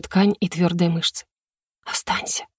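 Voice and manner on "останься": breathless whisper; whisper between kisses. Acted. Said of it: "прошептала я между поцелуями".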